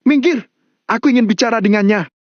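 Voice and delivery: high, rushed furious command; enraged yell at normal volume; angry shout. A narrator's reading. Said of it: "teriak Mario sambil mengayunkan pisau".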